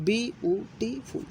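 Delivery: বিউটিফুল slowly in four separate beats; বিউটিফুল chopped into its four syllables. Shown as a demonstration. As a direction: count out bi, u, ti, ful in বিউটিফুল with small gaps. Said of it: "চারবার উচ্চারণ করছি".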